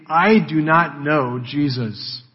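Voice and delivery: "I do not know Jesus" slow and measured, each word weighted; emphatic denial. Acted. Peter disowning Jesus, slow emphatic "I do not know Jesus". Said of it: "he's stated emphatically".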